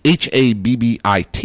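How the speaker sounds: H A B B I T spelled letter by letter, slow; each letter of H A B B I T said separately. Shown as a demonstration. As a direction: spell out H A B B I T slowly. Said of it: "bad habit".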